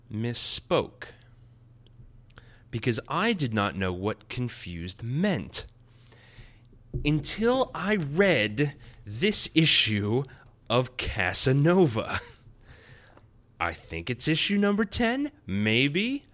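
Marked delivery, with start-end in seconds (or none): 2.7-12.2 slow, drawn-out, louder build; mock dramatic confession; exaggerated emphasis. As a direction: stretch it out for the joke. Comic exaggeration of confusion.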